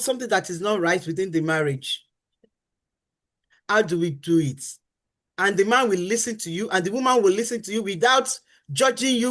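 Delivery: usual speaking voice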